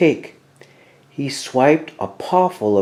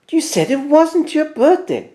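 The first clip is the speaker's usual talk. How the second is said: much higher teasing Mouse voice; high squeaky Mouse. A narrator's reading. Mouse catching Bear out, in a high teasing voice. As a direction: tease Bear in a high little voice.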